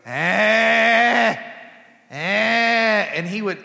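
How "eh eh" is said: loud high demanding "eh"; baby fussing for more chicken; toddler whining grunt. Retold or imitated. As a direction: whine like a hungry toddler for more. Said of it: "he looked at me and go".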